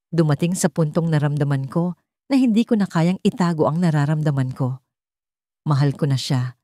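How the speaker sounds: ordinary delivery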